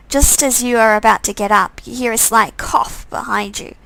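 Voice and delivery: ordinary talk, nothing marked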